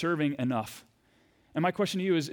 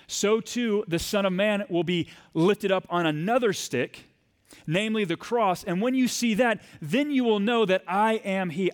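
rising preaching; 4 semitones higher than usual. Acted. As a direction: proclaim it slowly from the pulpit. Preaching climax on the cross.

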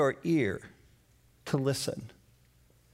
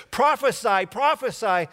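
jeering, raised taunt at a blindfolded man; louder mocking jeer; 5 semitones higher, 4 dB louder than usual. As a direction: taunt him like a guard. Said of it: "struck him with their fists and said".

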